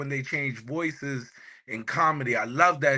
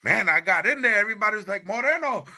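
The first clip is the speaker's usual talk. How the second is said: put-on Panamanian accent; 9 semitones higher, faster than usual. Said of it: "he sounds mad Panamanian".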